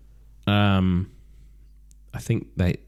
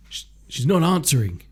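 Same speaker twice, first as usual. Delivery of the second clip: higher, stammered mock panic; mock alarm; 7 semitones higher, 4 dB louder than usual. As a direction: play a flustered caller getting no answer. Acts out Jack on the phone.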